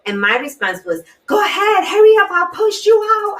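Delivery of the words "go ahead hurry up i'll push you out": high, loud, furious screaming yell; irate shout. Retold or imitated. To her threatening boyfriend, a high loud irate scream. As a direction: scream it at him, pushing him away. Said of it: "I was so irate with him".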